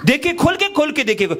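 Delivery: high hawker calling for the lady of the house. Retold or imitated. Hawker calling the housewife to the door. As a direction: call into the house.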